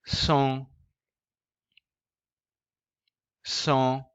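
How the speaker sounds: "Son" with a nasalized o; "Son" said through the nose; nasal son. Shown as a demonstration. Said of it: "Now the nasal sound".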